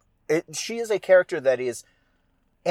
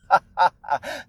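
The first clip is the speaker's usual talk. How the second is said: throaty sea-witch chuckle; short theatrical chuckle; villainess laugh; 5 semitones higher than usual. Acted. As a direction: laugh in character as a scheming witch.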